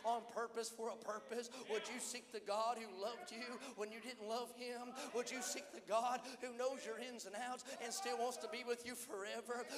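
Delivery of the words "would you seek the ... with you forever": ordinary delivery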